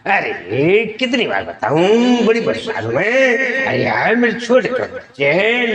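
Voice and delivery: high, swooping comic whine; Johnny Lever. Acted. Exasperated comedian insisting who he is, Johnny Lever impression. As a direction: stretch the vowels, sliding up and down in exasperation. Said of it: "आपने जानी liver जी की आवाज़".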